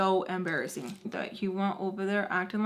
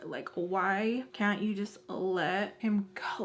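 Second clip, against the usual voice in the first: slow hushed whisper; slower than usual. Acted. Asking herself why she can't let go, whispered. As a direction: breathe it out slowly.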